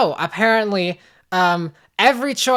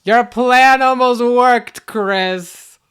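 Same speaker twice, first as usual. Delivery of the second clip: louder, higher, drawn-out taunt; gloating villain; 4 semitones higher, 5 dB louder, much slower than usual. Pretend. Evil role retaking the taunt to Chris, grand gloat. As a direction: stretch it big and theatrical.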